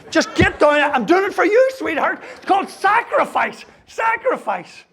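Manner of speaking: high, hammed-up pleading noble martyr; mock noble sacrifice. Hamming it up to his wife about his sacrifice, high and pleading. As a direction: squeal it up like a self-sacrificing hero.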